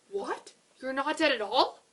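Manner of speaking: high, loud astonished shout; yelled in surprise. A narrator's reading. The animals yell at Kevin in astonishment, high and loud. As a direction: shout in disbelief. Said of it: "they yelled".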